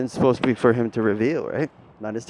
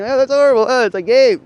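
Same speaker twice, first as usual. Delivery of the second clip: mock shock; 10 semitones higher, 12 dB louder than usual. Mock reaction of a listener.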